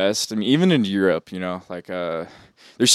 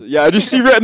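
loud echo; 11 semitones higher, 9 dB louder than usual. Retold or imitated. Echoing friend's line, louder.

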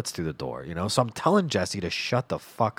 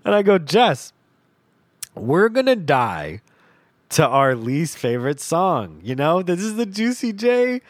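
laughing; 9 semitones higher, 5 dB louder than usual. Real, not acted. Laughing while retelling.

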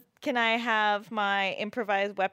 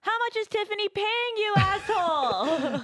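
high, drawn-out yell; yelled accusation; 11 semitones higher than usual. Acted. Injured character yelling at Jeremy, high and accusing. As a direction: hurl it up the escalator. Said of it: "you yell that out loud".

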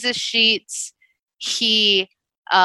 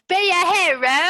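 high, laughing mock British accent; 9 semitones higher than usual. Imitating the movie's British voice while laughing. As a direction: giggle through a fake British accent. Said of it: "British accent".

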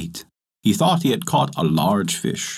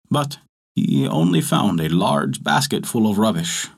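ordinary delivery, nothing marked

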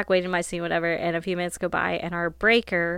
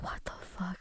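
a stunned, breathless whisper under the breath; shocked whisper; 15 dB quieter than usual. Retold or imitated. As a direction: let it slip out under your breath, stunned. Shocked after watching the video.

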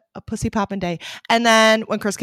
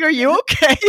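higher, louder worried check-in; concerned boyfriend; 8 semitones higher, 6 dB louder than usual. Retold or imitated. Chris checking on crying girlfriend, mock worried. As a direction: ask it like you're alarmed. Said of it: "He's like".

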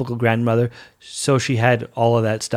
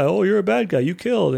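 higher, sing-song taunt of "bad guy"; mock accusing officers; 6 semitones higher than usual. Officers accusing Kirk of murder, higher sing-song taunt. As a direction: taunt him a second time, pushing higher. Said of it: "And people are like".

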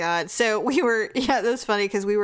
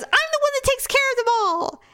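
much higher whiny mock voice of husband. Wife mocks husband's claim about the cats, high mock whine. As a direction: send him up in a squeaky whine.

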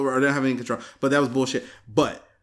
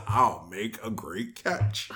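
lower, softer, slow motherly praise; mom's voice; 7 semitones lower, 7 dB quieter, slower than usual. Retold or imitated. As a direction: reassure like a proud mom. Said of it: "she says".

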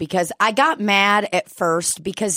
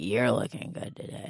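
put-on lower, softer old man's compliment; mock Donald greeting; 8 semitones lower, 10 dB quieter than usual. Imitating old barfly Donald greeting her, lightly voiced. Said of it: "Donald will be like".

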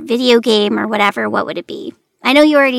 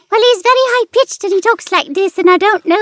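high squeaky Scottish alpaca; cartoon alpaca, much higher, Scottish; 8 semitones higher than usual. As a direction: play a tiny alpaca with a Scottish lilt. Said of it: "my alpaca Scottish character".

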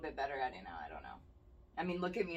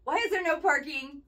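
louder, higher frustrated rant; exasperated rant; 8 semitones higher, 5 dB louder than usual. Retold or imitated. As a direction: vent the annoyance. Ranting about shopping crowds.

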